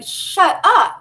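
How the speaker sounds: loud high shriek of disbelief; stunned teen shouting at the nurse; mock disbelieving shout. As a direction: yell it in shocked delight. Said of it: "I'm like, I'm like".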